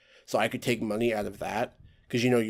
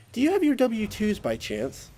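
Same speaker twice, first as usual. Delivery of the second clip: much higher, sweetly oblivious clerk; mock clueless lady; 10 semitones higher than usual. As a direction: ask it like she hasn't been listening. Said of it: "And then the lady's just like".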